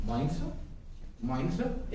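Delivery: quiet, mumbled "morning sir"; soft polite greeting. Shown as a demonstration. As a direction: greet softly like a visitor. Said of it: "एक तो इस तरह बोले". (धीमे से "morning sir")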